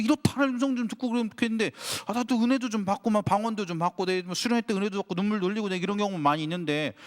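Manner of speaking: 높은 목소리로 답답해 투덜대는 흉내; 속이 터진 듯 하나님께 따지듯; frustrated complaint. Retold or imitated. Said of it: "너무 열이 받아서". (답답하고 억울한 듯 높게 투덜거리기)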